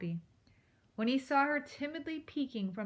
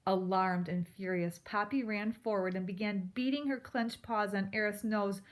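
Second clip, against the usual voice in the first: usual speaking voice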